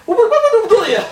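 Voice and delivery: high, loud, laughing disbelief; shocked disbelief. Retold or imitated. Speaker retelling his stunned reply to a friend, high laughing disbelief. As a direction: blurt it out, caught off guard and laughing.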